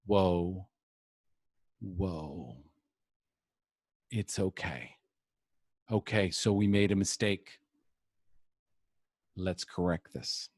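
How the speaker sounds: calm yourself down like a kind friend; reassuring self-talk. Retold or imitated. Speaker comforting himself after a mistake, softly reassuring. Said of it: "or I can go".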